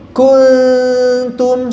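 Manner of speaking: kuntum thin, tongue kept away; correct kuntum. Shown as a demonstration.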